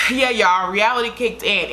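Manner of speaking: ordinary talk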